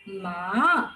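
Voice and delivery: "ma" dipping low, then rising; third-tone "ma", long low scoop upward; falling-rising third tone. Shown as a demonstration. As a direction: draw "ma" down low and lift it at the end. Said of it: "the fall and the rise".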